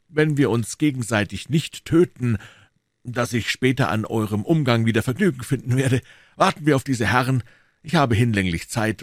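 courteous duelist waiting; a hint of a stately musketeer; Athos. Athos voiced in read story.

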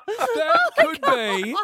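words squeezed out through a laugh; high, slow, giggling through it; cracking up while speaking. Real, not acted. Laughing mid sentence.

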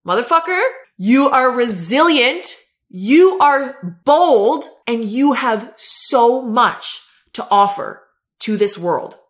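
slow, loud, emphatic conviction; like you mean it. Shown as a demonstration. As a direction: tell a struggling best friend so they believe it. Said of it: "you're gonna say it like you mean it".

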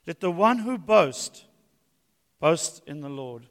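emphatic scripture reading; proclaiming the text to the church. Acted. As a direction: read it out with weight. Reads scripture aloud.

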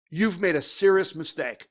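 ordinary talk, nothing marked

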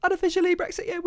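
very high-pitched silly interjection. Acted. Silly echo of host's intro.

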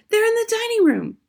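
high, loud urgent warning; mother's high, frightened urgency; alarmed hiss. A narrator's reading. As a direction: warn them in a high panic. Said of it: "hissed the mother".

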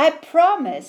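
little crab promising. A narrator's reading.

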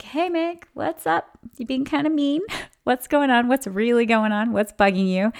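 starts high, sing-song, settling down; playful teasing. Retold or imitated. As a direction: coax your inner critic playfully. Talking to her mean girl voice.

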